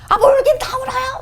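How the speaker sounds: re-enacted high-pitched angry outburst; furious retort. Crying woman snaps back at the Holy Spirit, high angry yell. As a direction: snap back way up high, in exasperation. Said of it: "진짜 너무 화가 나 있었으니까".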